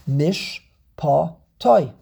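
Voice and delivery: "Mishpatoi" broken slowly into mish, pa, toi; slow teacher's split of "Mishpatoi"; syllable by syllable. Shown as a demonstration. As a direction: count out "Mishpatoi" with pauses between syllables. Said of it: "how many syllables are there in the word".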